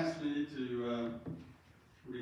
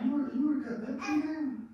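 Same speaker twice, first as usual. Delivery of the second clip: disbelieving question; 13 semitones higher, 6 dB louder than usual. Retold or imitated. Asks Sarah, disbelieving, about the tree.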